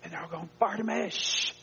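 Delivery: high-pitched name, then a sharp shh; scolding shush from the crowd. Acted. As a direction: hush someone making a scene. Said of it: "many warned him to be quiet".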